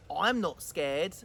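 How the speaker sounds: slow stubborn little gruffalo; low slow child gruffalo boast; defiant child monster. Acted. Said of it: "said the Gruffalo's child".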